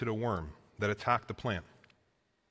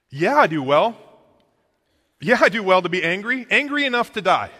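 defiant, loud and higher retort; angry retort; 8 semitones higher, 12 dB louder than usual. Retold or imitated. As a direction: spit it back at God. Jonah answers God in anger.

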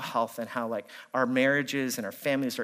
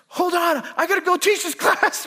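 high, loud exasperated cutoff; husband cutting off the fight, high and loud; heated brush-off; 6 dB louder than usual. Retold or imitated. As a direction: snap that you have to go. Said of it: "I'm heated, I'm angry".

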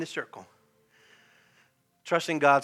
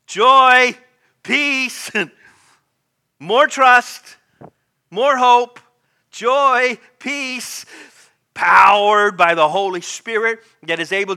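raised, loud, driving sermon delivery; full-force preaching; 4 semitones higher, 12 dB louder than usual. Real, not acted.

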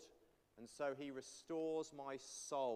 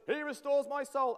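much higher and louder; doubly emphasized; 11 semitones higher, 9 dB louder than usual. Shown as a demonstration. Congregation reciting the psalm together: loud, high emphasis. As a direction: belt out "He restores my soul". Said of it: "we've got to emphasize that doubly".

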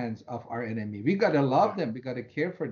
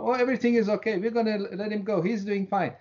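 mock reassurance; 7 semitones higher, faster than usual. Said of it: "they used to tell my mom".

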